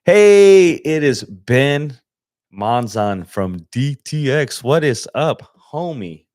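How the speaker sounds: usual speaking voice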